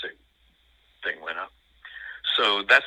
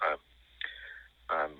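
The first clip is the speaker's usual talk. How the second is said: ordinary delivery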